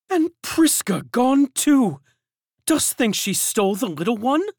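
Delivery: high, loud questioning woman; high anxious servant. A narrator's reading. Servant asks the others about the nurse, high and loud. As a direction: ask in worried alarm.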